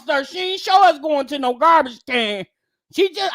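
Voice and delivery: ordinary talk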